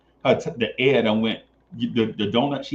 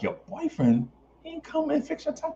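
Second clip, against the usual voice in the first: mock incredulous; 14 semitones higher, 6 dB quieter than usual. Quoting himself to the woman.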